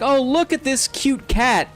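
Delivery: lightly voiced doting mom; delighted mom. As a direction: coo over the cat like a fooled mom. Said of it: "the mom would be like".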